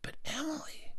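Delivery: high, breath-light whisper; hushed shock. Acted. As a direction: barely voice the disbelief. Hushed shock at seeing Emily.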